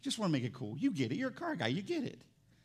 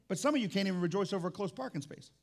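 usual speaking voice

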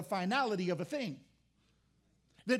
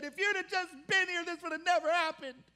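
higher, pleading complaint to Jesus; pleading Martha; 7 semitones higher, 3 dB louder than usual. Retold or imitated. Martha rushing out to Jesus, pleading complaint. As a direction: lift the voice in reproach.

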